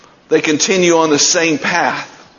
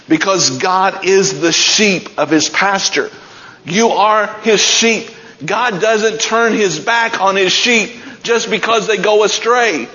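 preaching at full force; 7 semitones higher than usual. Acted. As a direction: preach it to the rafters, slowing on the climax. Preaches God's love for sheep.

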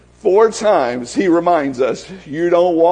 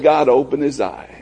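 prayer; 6 semitones lower, 3 dB quieter than usual. Acted. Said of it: "He said".

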